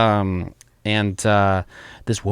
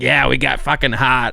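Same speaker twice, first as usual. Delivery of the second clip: mock Boston accent; 5 semitones higher, 5 dB louder than usual. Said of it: "we wouldn't stop saying it".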